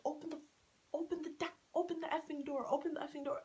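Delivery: high mock yell of a drunk man; mock angry shout. Mocking drunk boyfriend yelling at taxi. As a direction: imitate him demanding the door open. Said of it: "he literally got in".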